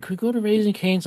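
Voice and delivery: put-on high little kid asking. Dad voices his kid asking.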